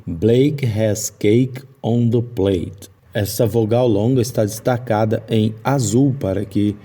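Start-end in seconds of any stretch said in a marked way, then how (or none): 0.1-2.9 each long A drawn out slowly; slow example sentence. Shown as a demonstration. Teacher reads a long A example sentence slowly. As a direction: read it slowly, leaning on every long A. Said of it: "temos uma vogal longa".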